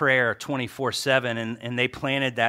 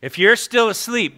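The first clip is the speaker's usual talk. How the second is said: playful jab; 8 semitones higher, 5 dB louder than usual. Real, not acted. Teasing sleepy congregation.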